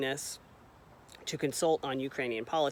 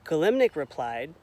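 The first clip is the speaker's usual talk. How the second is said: normal talking voice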